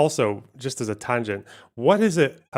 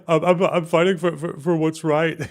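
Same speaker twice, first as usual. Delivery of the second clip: mocking imitation; 5 semitones higher, 3 dB louder than usual. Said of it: "obviously he hasn't changed".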